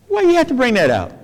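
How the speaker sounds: high, fast, mock-indignant challenge; hammed-up huffy retort to the preacher; indignant objection. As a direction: protest like a touchy listener who feels called out. Voicing objection to the text.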